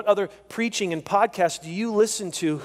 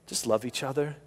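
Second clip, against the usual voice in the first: soft summing up; 5 semitones lower, 7 dB quieter than usual. Acted. Preacher distilling the teaching for the congregation, softly. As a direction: drop to a soft, intimate close.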